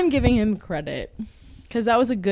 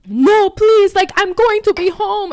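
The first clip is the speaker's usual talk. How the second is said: high, loud mock begging; French boy; 10 semitones higher, 9 dB louder, slower than usual. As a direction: plead frantically, imitating him. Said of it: "French boy".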